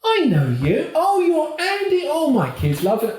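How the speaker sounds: a hint of an excited midwife recognising him; imitating the midwife. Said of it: "she goes".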